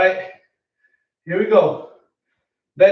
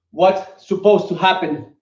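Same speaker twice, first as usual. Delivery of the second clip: normal talking voice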